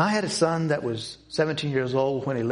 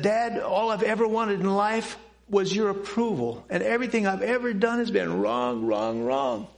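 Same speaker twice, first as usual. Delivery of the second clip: tearful plea; 6 semitones higher than usual. Retold or imitated. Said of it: "and he was crying".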